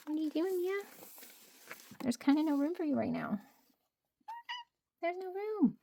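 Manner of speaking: high pet-talk voice; talking to the cat. Real, not acted.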